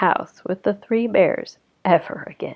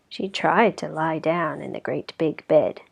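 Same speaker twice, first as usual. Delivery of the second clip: ordinary delivery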